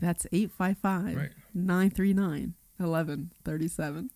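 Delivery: slow, low sultry reading; sultry narrator. Shown as a demonstration. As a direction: stretch the numbers out seductively. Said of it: "Can I do a sexy voice?".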